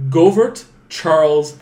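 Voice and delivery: slow, loud, name by name; big deliberate roll-call of one name; laboured name reading. Shown as a demonstration. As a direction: announce each foreign name slowly and emphatically. Struggles through a long foreign name.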